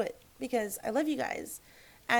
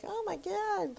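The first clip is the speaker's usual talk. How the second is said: mock surprised gasp; 9 semitones higher, slower than usual. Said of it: "I'm like".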